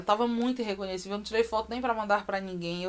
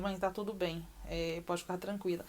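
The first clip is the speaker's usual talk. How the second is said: calm reassurance; 9 dB quieter than usual. Retold or imitated. Doctor calming the worried mother.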